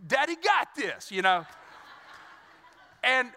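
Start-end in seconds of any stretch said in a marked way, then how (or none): 0.1-1.1 high, put-on reassuring dad voice; cocky reassurance, pitched up; confident husband. Husband reassuring his crying wife, mock confident and high. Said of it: "and I'm like".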